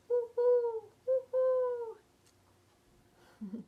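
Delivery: high hooting owl call. Acted. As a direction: hoot like a little owl. Owl hoot imitation.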